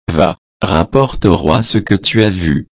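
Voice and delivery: ordinary delivery, nothing marked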